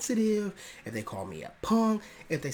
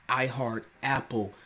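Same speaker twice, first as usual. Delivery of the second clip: usual speaking voice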